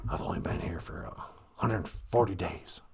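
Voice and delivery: breathy mock whisper, slowing down; Joe Biden. Mocking the president's new habit to listeners, whispered. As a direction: imitate a pointed stage whisper. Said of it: "whispering thing".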